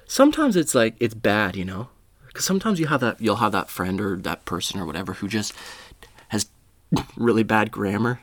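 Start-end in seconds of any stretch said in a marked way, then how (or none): none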